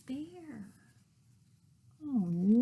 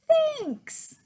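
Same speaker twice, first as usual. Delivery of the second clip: squeaky high thanks; high squeak. Acted. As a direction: squeak it like a tiny creature. Bear's reply in story.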